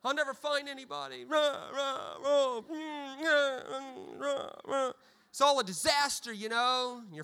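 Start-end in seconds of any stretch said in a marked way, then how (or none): 0.0-1.3 raised, pleading lament to God; mock lovesick wail. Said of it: "And I was like". 1.3-4.9 raised, hammed-up wordless bawling; fake-cry like a heartbroken kid; mock blubbering sobs. Heartbroken fourteen-year-old bawling, hammed-up sob noises. Mock sobbing over breakup.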